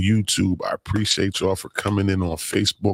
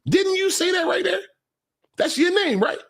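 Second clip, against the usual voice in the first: mock accusation. Real, not acted. Calling out a commenter.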